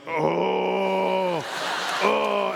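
long chant-like imitated moan; copycat moan. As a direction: moan along like meditators following suit. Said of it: "Two people started following me".

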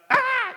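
short high-pitched scream; startlingly loud imitation of a child's scream; sudden scream. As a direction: blurt out a loud, piercing "Ah!". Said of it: "didn't mean to scream".

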